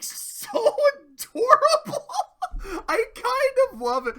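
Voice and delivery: high, slow, laughing squeal; gushing laugh. Real, not acted. Gushing to viewers about the cute face, laughing squeal. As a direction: squeal with delight through laughter.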